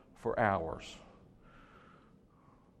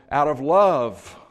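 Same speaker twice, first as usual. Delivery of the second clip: out of love dragged out slow and much louder; stressed love; 3 semitones higher, 13 dB louder, slower than usual. Acted. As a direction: lean hard on "love", slow and booming. Preacher stressing love as motive.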